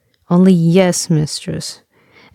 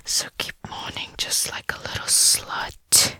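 soft, intimate whisper; coaxing whisper; 9 dB quieter than usual. Acted. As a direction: coax in a hushed whisper.

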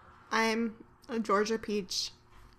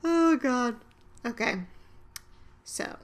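usual speaking voice

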